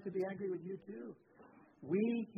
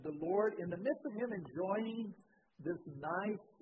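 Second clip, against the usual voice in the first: ordinary talk, nothing marked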